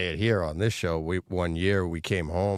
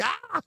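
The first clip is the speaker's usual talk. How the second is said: mock panicked yelp. Retelling his fright to his co-host, high mock yelp. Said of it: "I'm like".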